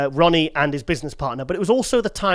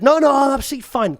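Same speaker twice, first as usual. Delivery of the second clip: high, falsely breezy denial; fake cheerful; 9 semitones higher than usual. Brushing off his friend while sulking, falsely cheerful. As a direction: pretend all is well, chirpy. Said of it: "Total lie".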